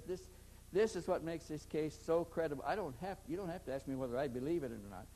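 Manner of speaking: normal talking voice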